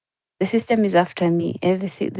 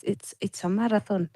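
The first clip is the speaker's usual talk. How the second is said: ordinary delivery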